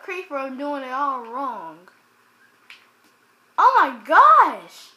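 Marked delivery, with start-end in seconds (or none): 3.6-4.9 loud, slow, falling groan of dismay; slow loud dismayed exclamation; drawn-out exasperated oh my gosh. Real, not acted. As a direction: stretch it out, fed up. Chaos in game.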